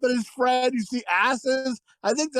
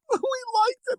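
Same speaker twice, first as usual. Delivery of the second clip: much higher squealed line; mock squeal; 13 semitones higher than usual. Reliving the movie line for friends, high squeal. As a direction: squeak it out, delighted.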